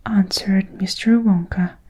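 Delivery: usual speaking voice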